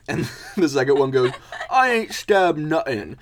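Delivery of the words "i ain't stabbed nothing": loud, high bruiser voice; hammed-up mole henchman; indignant denial. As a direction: deny it loudly as a put-upon thug. Second mole denies it.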